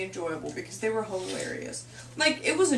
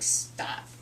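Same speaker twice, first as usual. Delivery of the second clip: whispered stop; 5 dB quieter than usual. Retold or imitated. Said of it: "I'm like".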